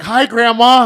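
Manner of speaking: put-on high, loud little kids' hello; a touch of little kids calling Grandma; kids greeting. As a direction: chirp it like small children. Kids greeting in retold story.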